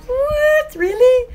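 high-pitched disbelieving reaction; mock surprise. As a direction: act out startled disbelief, voice jumping up. Acting out her own disbelief.

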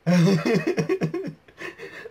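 a high burst of laughter. Real, not acted. Reaction video, laughing.

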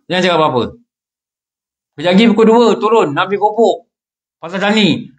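ordinary talk, nothing marked

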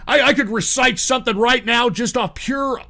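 ordinary talk, nothing marked

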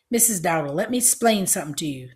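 ordinary delivery, nothing marked

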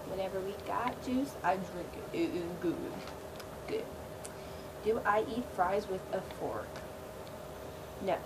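usual speaking voice

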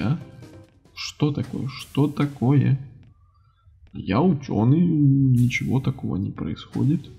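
normal talking voice

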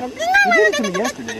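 an indignant shopkeeper squeaks at the customer; squeaky outburst. Acted.

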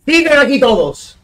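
high, heated complaint; indignant rant. Real, not acted. Protesta indignado por los baneos. (protesta indignada, en tono agudo)

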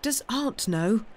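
a touch of fright; burst out, affrighted. A narrator's reading. Nephew hearing a step on the stairs, mildly affrighted. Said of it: "burst out Sam in affright".

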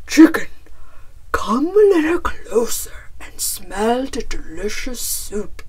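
loud bear voice, luring; coaxing bear. A narrator's reading. Bear invites Chicken to the pot, loud coaxing bear. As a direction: beckon loudly as a big hungry bear. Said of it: "Bear said".

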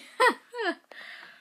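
sudden high burst of laughter. Real, not acted. Vlogger laughing at her lazy plans, high giggle. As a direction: break into a loud giggle.

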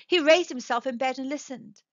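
ordinary delivery

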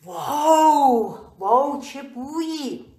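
drawn-out mock swoon; hammed-up wow. Playing up the clove smell for viewers, drawn-out mock wow. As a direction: stretch the wow like an act. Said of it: "ادا اطفال بسه".

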